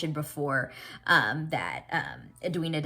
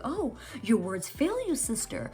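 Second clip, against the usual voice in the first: high, mocking jab; acted scorn in a raised pitch; scornful confrontation; 8 semitones higher than usual. Edwina confronting her silent sister, scornful.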